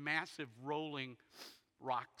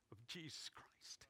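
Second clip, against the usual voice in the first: slow breathy whisper on the last words; hushed whisper; 4 semitones lower, 14 dB quieter, slower than usual. Real, not acted. Preacher to congregation, slow hushed whisper. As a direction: drop to a near whisper, slowly.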